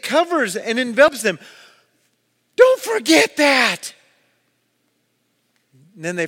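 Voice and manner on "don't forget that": high, loud, drawn-out command; preacher's ringing shout; emphatic shout. Real, not acted. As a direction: shout it slowly to the crowd. Preacher urging congregation to remember.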